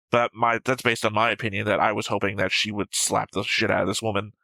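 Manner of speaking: normal talking voice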